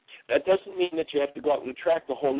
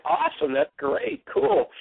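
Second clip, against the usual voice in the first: excited approval; 6 semitones higher, slower than usual. Retold or imitated. Jeannie reacts to good news.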